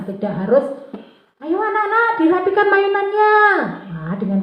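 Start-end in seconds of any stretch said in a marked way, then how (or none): 1.4-3.4 high sing-out to the class; mock calling out. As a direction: imitate a teacher calling children to tidy up. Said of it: "teriaki seperti itu". (pura-pura meneriaki anak, nada tinggi melengking)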